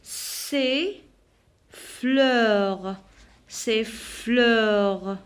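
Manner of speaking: "C'est fleur" slowly, no final s; drawn-out dictation of "C'est fleur", S left silent. Shown as a demonstration. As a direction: stretch "fleur" and end on the r. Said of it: "je prononce pas la lettre S".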